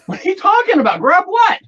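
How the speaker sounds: indignant at the intruder; alarmed and upset. Acted. Acted scene catching Earl inside.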